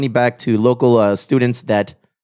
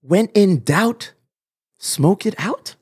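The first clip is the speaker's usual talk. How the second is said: ad read; 6 semitones higher, much slower than usual. Acted. Sponsor slogan, slow.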